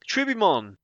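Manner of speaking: ordinary talk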